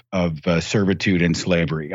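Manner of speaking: normal talking voice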